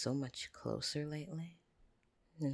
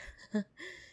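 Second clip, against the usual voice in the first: nervous high laugh. Acted.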